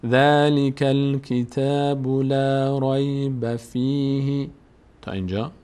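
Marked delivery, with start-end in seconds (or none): 0.1-4.5 level, drawn-out syllables with long held vowels; slow Quran reading. Shown as a demonstration. Teacher reading the verse first, slow measured recitation. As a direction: recite it slowly on one even note. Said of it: "اول بخونیم".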